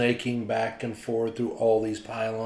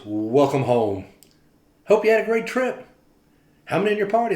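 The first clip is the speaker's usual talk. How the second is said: louder and higher, a beaming welcome; cheerful greeter; 7 semitones higher, 6 dB louder than usual. Retold or imitated. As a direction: welcome the weary traveller like family. Greeter speaking to the narrator.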